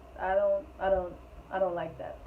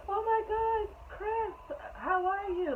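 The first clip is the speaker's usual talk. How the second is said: much higher, squealing mock greeting; imitating excited girl; 13 semitones higher, much slower than usual. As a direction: mimic her gushing over Chris. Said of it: "like she was excited to see him".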